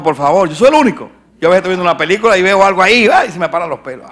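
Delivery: loud, high fiery sermon voice; full-force preaching. Acted. Preacher booming at his congregation, fiery.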